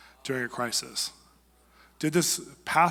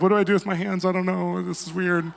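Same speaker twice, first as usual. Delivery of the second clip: higher mock-baffled advisors; awkward advisors; 5 semitones higher, 5 dB louder than usual. Advisors watching their leader weep, higher baffled voice. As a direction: play the lost, awkward onlookers. Advisors unsure while he weeps.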